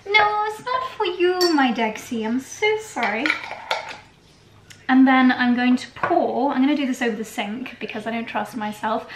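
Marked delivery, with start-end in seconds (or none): 0.1-3.3 cooing to a pet. Real, not acted. Talking to Dexy the pet who wants some, high cooing.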